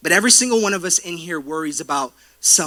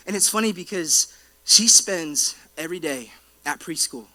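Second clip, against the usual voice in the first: normal talking voice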